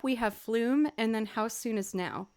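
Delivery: ordinary talk, nothing marked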